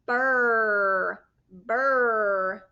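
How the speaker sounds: loud held burr, like shivering; burr, drawn out like a shiver; shivery burr. Shown as a demonstration. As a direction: burr as if you're cold. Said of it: "like I'm cold".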